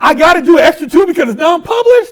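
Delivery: loud, high incredulous protest; indignant disbelief. Retold or imitated. Client facing an extra two years, loudly indignant. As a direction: protest it in comic outrage. Retells outrage at attorney's answer.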